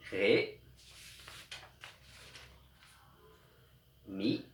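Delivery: each note name said slowly and apart; name each note slowly for children to repeat. Shown as a demonstration. Teacher naming the notes for pupils, each one slow and apart. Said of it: "on va les revoir ensemble".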